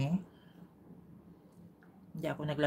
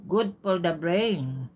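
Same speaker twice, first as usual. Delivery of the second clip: spelled out slowly, louder; slow and deliberate; 2 semitones higher, 6 dB louder, much slower than usual. Acted. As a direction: announce it slowly like a fact. Slow announcement after pause.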